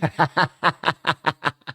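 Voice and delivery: high staccato cackle; helpless laughter. Real, not acted. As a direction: burst out laughing in a rapid string. Laughing at the phrase.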